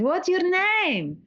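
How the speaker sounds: slow, high, sing-song talking-down voice; drawn-out, high and sugary, like to a toddler; patronising waiter. Retold or imitated. Waiter talking down to Ollie over ice cream, patronising. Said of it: "he was being really patronising".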